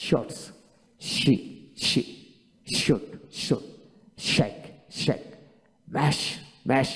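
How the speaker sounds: each word said twice with the same even force; steady, careful word-list reading; word drill, each twice. Shown as a demonstration. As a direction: repeat every sh word twice, evenly, like a pronunciation drill. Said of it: "তাই আপনাকে".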